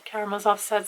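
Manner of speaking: usual speaking voice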